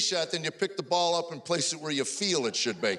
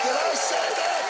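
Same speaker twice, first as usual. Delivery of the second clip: high and loud, quicker; mock shock; 9 semitones higher, 4 dB louder than usual. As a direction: repeat it in fake disbelief.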